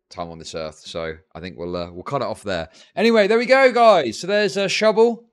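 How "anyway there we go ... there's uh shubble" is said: much higher and louder cheerful outro; loud upbeat shift. Real, not acted. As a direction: burst back into hype outro voice.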